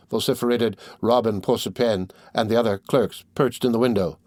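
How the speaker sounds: ordinary delivery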